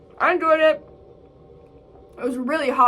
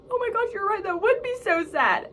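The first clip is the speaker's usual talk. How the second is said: retold tearful reaction; 9 semitones higher than usual. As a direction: imitate being moved to tears. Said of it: "I start crying 'cause I'm like".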